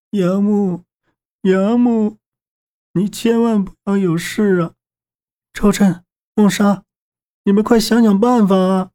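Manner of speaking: high, tearful pleading; choked sobbing. A narrator's reading. 夏洛伊 begging friends to save wounded 杨木, choked with sobs. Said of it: "哽咽道".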